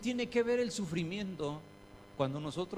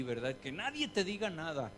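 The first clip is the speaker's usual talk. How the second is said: ordinary talk, nothing marked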